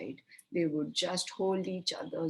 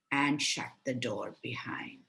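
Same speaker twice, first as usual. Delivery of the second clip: ordinary delivery, nothing marked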